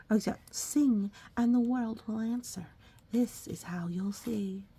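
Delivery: ordinary delivery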